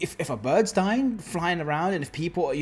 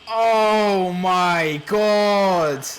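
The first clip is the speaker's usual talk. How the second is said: slow, loud, drawn-out gasp of dread; adrenaline shakes; 4 semitones higher, 9 dB louder, much slower than usual. Real, not acted. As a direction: breathe out the tension. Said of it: "my heart is racing right now".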